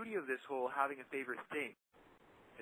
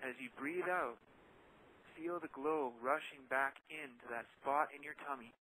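softly paced guided-imagery narration; guided meditation; 5 dB quieter than usual. Acted. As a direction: ease listeners into calm, phrase by phrase. Leads a guided relaxation.

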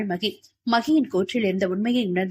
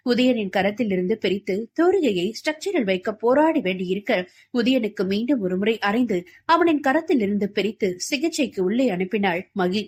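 usual speaking voice

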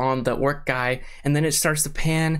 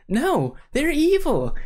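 high, drawn-out objection; exasperated protest; 13 semitones higher, slower than usual. Real, not acted. Fan refusing to sympathize with orcs, exasperated high protest.

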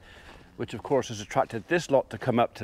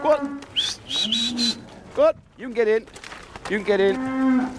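sing-song herding call pitched up high; calling to cattle; 12 semitones higher than usual. Real, not acted. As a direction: call out to the calves to get them moving. Coaxing cattle through gate.

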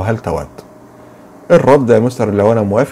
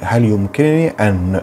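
normal talking voice

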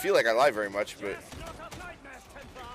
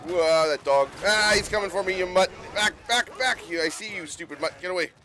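alarmed yelling at a dog; 8 semitones higher than usual. Real, not acted. Player shooing an attacking game dog, alarmed. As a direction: back off, you mutt.

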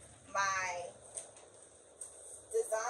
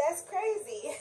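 laughing; 9 semitones higher, 6 dB quieter than usual. Real, not acted. Laughing at the missed spot.

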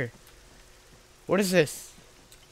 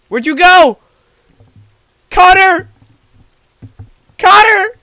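screaming a name in panic; frantic calling out; 16 dB louder, slower than usual. Real, not acted. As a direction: yell for your friend, terrified. Lost partner after scare.

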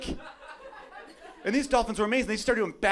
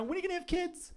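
nosy high question; 12 semitones higher, 9 dB quieter than usual. Retold or imitated. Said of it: "random people you barely know".

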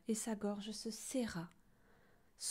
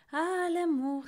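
high, louder, delighted sigh of wonder; tender wonder; 8 semitones higher, 5 dB louder, slower than usual. A narrator's reading. Gros Louis watching the two friends, touched and marvelling. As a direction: swoon over the young sweethearts. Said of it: "s'émerveilla Gros Louis attendri".

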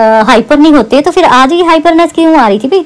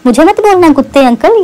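higher, whiny mock of the sister; mocking the sister's words, pitched higher; 4 semitones higher than usual. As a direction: parrot the sister's taunts to sneer at her. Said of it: "ये तुम्हारी बहन बोलती है".

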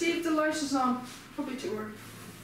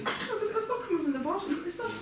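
higher, agitated insistence; exasperated; 8 semitones higher than usual. Real, not acted. Showing a companion where the spray goes wrong, exasperated. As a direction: protest with rising pitch, words repeating. Arguing about how the spray opens.

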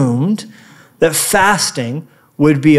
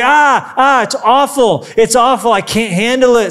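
higher, hammed-up whine; higher, overdone mock complaint; mock whining; 4 semitones higher than usual. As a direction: whimper like a dramatic sufferer. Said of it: "He's like".

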